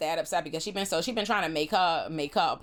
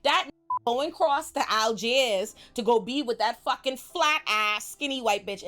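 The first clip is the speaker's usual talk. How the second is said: mock furious Claudia; 6 semitones higher than usual. Claudia exposing Lestat to Louis, hammed-up fury. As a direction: blow his secret open, heated. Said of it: "And she say".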